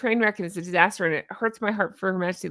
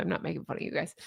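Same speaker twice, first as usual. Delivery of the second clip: breathy whisper, half to yourself; a quick hushed disclaimer; whispered aside; 6 semitones lower, 11 dB quieter, much faster than usual. Real, not acted. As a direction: whisper it to redheads.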